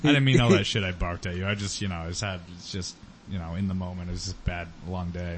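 a hint of an apologetic dog; low, sheepish apology as the dog; lightly voiced dog. Dog apologizes to owner, lightly voiced and sheepish. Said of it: "the dog's like".

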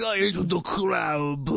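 put-on slow Japanese accent, lightly done. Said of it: "just him being like".

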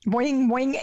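springy cartoon boing; bouncy springy boingy voice. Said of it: "I come from a cartoon background".

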